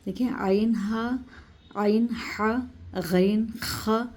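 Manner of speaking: आइन हा and गैन ख slow, pressed from the throat; throat letters. Shown as a demonstration. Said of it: "एकदम दबाकर हम यहाँ हलक को दबाकर". (हलक से दबाकर आइन हा, गैन ख)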